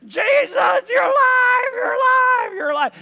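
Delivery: very high, loud wail of joy; hammed-up shriek, easing on the last repeat; ecstatic cry. Mary clings to risen Jesus, overjoyed shrieking.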